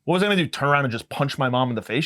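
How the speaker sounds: ordinary delivery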